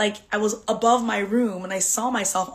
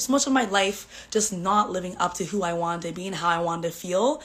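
ordinary delivery

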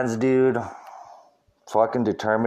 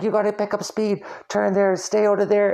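higher, mock-urgent girlfriend voice directing the driver; imitating his girlfriend; 9 semitones higher than usual. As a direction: playfully mimic her backseat directions, pitched up. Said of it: "She's like".

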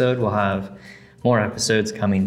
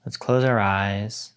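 guided meditation. Acted. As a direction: guide them gently into stillness.

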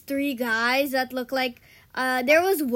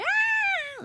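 sudden high-pitched "Ah!" of fright; high scared scream. Retold or imitated. Retelling nightmare to friend, a high frightened scream. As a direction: shriek like a scared small kid.

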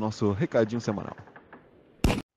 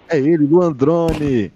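announcer call; 5 semitones higher, 11 dB louder, much slower than usual. Acted. Hyping up the guest's name.

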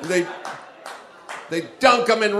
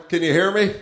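normal talking voice

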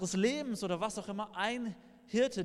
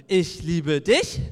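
loud, slow, drawn-out declaration; child's louder reply; 11 dB louder, much slower than usual. Retold or imitated. Toddler answering his papa's whisper, much louder. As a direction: belt it back like a small child. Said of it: "eher lauter zurück".

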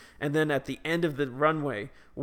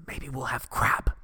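whisper. Retold or imitated. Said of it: "or in my mom's voice".

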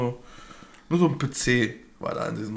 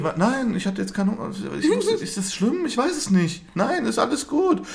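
flustered reply; 10 semitones higher than usual. Acted. Acts own flustered reply to nurse.

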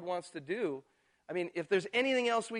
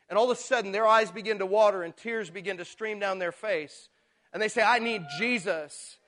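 fervent preaching; 3 semitones higher, 6 dB louder than usual. Real, not acted. Pastor testifying to the joy of witnessing, fervent. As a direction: swell up with conviction. Preacher rising to full force.

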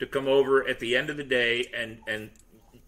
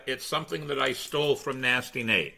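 ordinary talk

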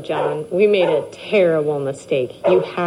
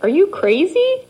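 alarmed; 12 semitones higher than usual. Acted. Wife alarmed at husband.

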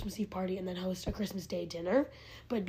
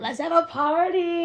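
excited call; 8 semitones higher, 9 dB louder, slower than usual. Real, not acted. Excited party plan.

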